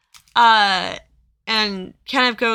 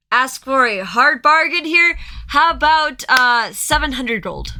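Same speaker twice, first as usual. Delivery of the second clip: louder, higher final offer; haggling merchant; 5 semitones higher, 7 dB louder than usual. Acted. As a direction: bid big to win them over. Merchant raising offer again.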